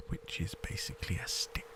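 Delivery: deadpan whisper under the breath; mock the gift in a hushed whisper; whispered aside. Real, not acted. Gamer mocking the old rod, whispered aside.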